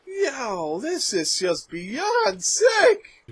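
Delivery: high-pitched, slow, stretched-out protest; appalled disbelief. Real, not acted. Viewer reacts to a kid in dad's blood, high appalled cry. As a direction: draw it out high in sheer disbelief.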